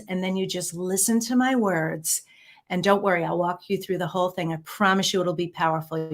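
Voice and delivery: ordinary talk, nothing marked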